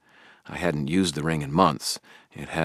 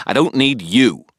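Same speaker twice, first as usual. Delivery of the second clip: loud, higher, cold dismissal; angry rejection; 9 semitones higher, 9 dB louder than usual. A narrator's reading. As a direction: slam the door on him. Harry's final angry rejection.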